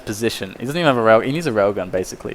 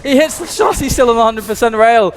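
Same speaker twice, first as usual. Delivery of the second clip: excited shout; 9 semitones higher than usual. Real, not acted. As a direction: yell it out in disbelief at the play. Caster sees impossible rail shots.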